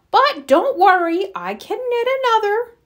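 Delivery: high, lilting grandmother voice. A narrator's reading. Grandmother offering to knit another, high reassuring voice. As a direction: lift it high to reassure a child. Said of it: "said the grandmother".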